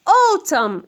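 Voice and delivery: "Autumn" said "otam", final N silent. Shown as a demonstration. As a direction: say "Autumn" ending on the M, no N sound. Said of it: "This N is silent".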